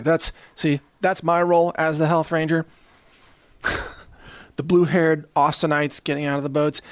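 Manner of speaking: normal talking voice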